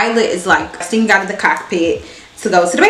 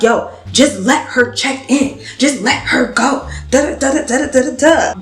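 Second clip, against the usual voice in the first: mock the line of people egging her on; imitating the crowd; 5 semitones higher than usual. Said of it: "people in line backing her up like".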